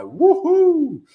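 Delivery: high, loud drawn-out whoop; mock rodeo whoop, stretched out; playful cheer. Streamer mimicking the American market opening, playful cheer. Imitating lively market open.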